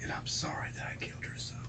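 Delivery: soft, barely voiced whisper; whispered confession. Real, not acted. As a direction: breathe the apology out with no voice. Confessing the killing to police.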